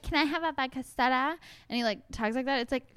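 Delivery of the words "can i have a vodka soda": higher-pitched silly little voice; mock woman's voice. Host mimicking a man's parody of a woman ordering a drink, mock girly voice. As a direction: imitate a guy mocking how women talk. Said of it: "like, a woman's voice".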